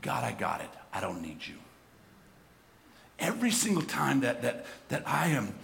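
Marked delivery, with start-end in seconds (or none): 0.0-1.5 soft, lower, dismissive inner voice; quiet voice of the sinner; mock self-assured quote, soft. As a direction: murmur it like a proud confession. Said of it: "what I'm doing is saying".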